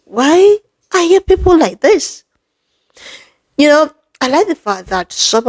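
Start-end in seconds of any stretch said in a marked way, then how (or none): 0.0-2.2 exasperated outcry. Real, not acted. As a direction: throw the question up in despair. Exasperated rant at critics.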